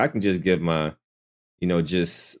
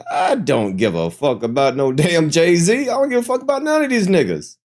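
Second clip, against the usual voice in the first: put-on not-caring rant, higher and higher; mock careless dismissal; 8 semitones higher than usual. Host mimicking people who dismiss [name], mock indignant. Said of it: "I'm not one of these people like".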